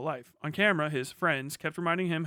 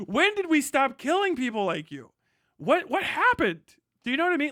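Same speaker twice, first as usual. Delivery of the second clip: outraged; 8 semitones higher than usual. Real, not acted. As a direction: shout out the outrage. Outraged rant at viewers.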